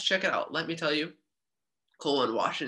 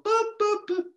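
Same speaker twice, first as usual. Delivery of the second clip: high playful boop noises; mock boops. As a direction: chirp it like a machine. Imitating factory sound.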